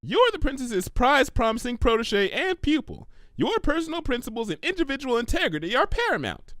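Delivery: high, fast, eager pony voice; bubbly Pinky. Acted. Pinky suggesting to Twilight, bubbly and quick. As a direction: blurt out a silly idea brightly.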